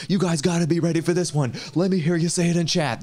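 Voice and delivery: high pumped-up streamer voice; lightly hammed-up hype; mock hype streamer. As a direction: play an over-eager streamer hyping chat. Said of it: "He's like".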